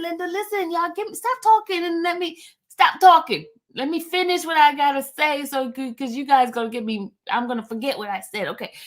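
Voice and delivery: ordinary delivery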